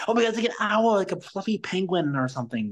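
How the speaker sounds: high-pitched mock ditzy girl; excited high girl voice, easing down at the end; imitated college girl. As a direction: imitate a clueless bystander, voice jumping up high. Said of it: "she literally said".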